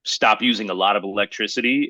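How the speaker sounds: ordinary delivery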